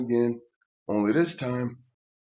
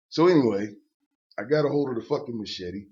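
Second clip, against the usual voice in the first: ordinary talk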